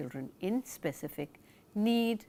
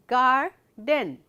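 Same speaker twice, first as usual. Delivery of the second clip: garden said louder and clearly for counting; demonstration word; 2 semitones higher, 8 dB louder than usual. Shown as a demonstration. Teacher testing syllables in garden. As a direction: say garden firmly for the class. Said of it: "how many syllables are there in this word".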